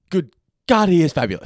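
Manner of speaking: high, loud flamboyant gush; flamboyant Wiggum. Retold or imitated. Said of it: "I love how flamboyant Wiggum is".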